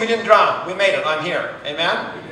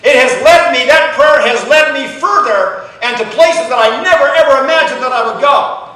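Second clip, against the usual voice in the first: loud, rising preacher's build to the congregation; full-force preaching; 4 semitones higher, 5 dB louder than usual. Acted. Pastor to congregation, about the prayer that led him, in full-force preaching. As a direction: preach it out big, lifting the church.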